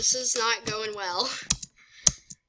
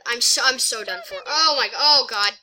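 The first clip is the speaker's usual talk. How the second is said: usual speaking voice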